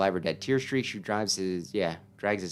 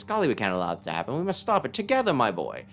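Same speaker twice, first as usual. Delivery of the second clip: put-on eccentric old sage; 4 semitones higher than usual. Player voicing the strange old mushroom sage for the boy. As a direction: coo it like a batty hermit who hears mushrooms.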